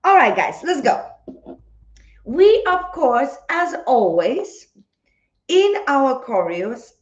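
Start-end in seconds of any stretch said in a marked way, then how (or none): none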